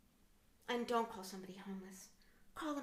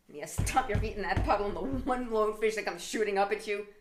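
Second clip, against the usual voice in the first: ordinary talk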